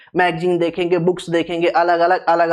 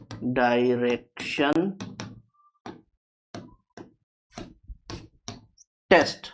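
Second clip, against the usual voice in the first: drawn-out dictation; 8 semitones lower, 9 dB quieter, slower than usual. Shown as a demonstration.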